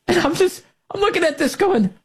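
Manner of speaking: high-pitched incredulous ranting; exasperated disbelief. Real, not acted. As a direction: pitch it up in mock bewilderment. Exasperated ridicule of the man.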